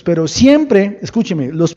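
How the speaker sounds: loud, slow, booming preaching; preaching at full force. Real, not acted. Pastor thundering to his congregation. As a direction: thunder it out to the church.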